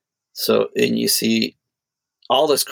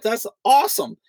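inner delight; 12 semitones higher than usual. Retold or imitated. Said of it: "in my head, I was like".

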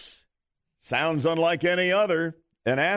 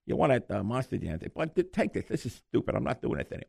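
Mike Tyson voice; 3 semitones lower, faster than usual. Acted. Host as the boxer greeting the winning caller, little teeny voice. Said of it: "finally recognized my voice".

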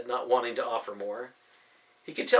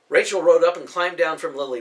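ordinary delivery, nothing marked

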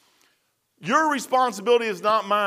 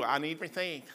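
exaggerated Sandy; 4 semitones lower, 11 dB quieter than usual. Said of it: "I'm saying it like that just to be".

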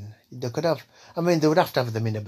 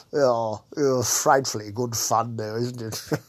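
louder put-on posh gentleman's voice, slow at first; posh Frank; 4 dB louder, slower than usual. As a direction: greet them grandly as a plummy toff. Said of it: "old posh fucking Frank here".

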